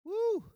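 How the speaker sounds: sudden high woo of joy; high woo shout. Real, not acted. Preacher whooping to the crowd, high excited woo. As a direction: whoop high and bright for the church.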